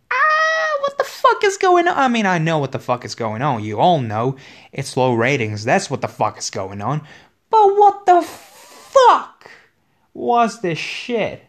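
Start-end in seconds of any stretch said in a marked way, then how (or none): 0.1-2.0 high, loud disbelieving shout; outraged yell. Real, not acted. Fan yelling at the show, high and loud. 7.5-11.4 slow, high, loud scream of disbelief; drawn-out outraged yell. Real, not acted. Fan screaming at the storyline, slow and high.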